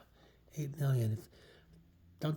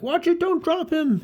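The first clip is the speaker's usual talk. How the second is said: high, loud, drawn-out call; alarmed warning shout; 9 dB louder, slower than usual. Acted. Onlookers watching Spider-Man carry Peter, alarmed shouting.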